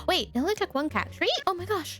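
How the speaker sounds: normal talking voice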